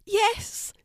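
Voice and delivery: high, squeezed through gritted teeth. A narrator's reading. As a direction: push it out through clenched teeth. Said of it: "she said between gritted teeth".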